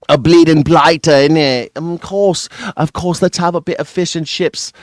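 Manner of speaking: hammed-up British slang voice; mock British accent. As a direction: imitate a caricature Englishman. Mocking the English accent again.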